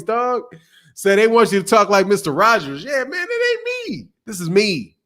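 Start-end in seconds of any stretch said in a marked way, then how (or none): none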